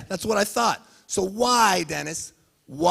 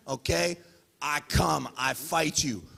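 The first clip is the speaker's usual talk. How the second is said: ordinary delivery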